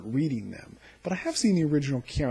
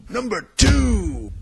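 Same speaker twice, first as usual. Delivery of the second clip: loud slow countdown call; 8 semitones higher, 11 dB louder, much slower than usual. Acted. Host announcing his second favourite moment, loud slow call. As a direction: stretch it out, much higher and louder.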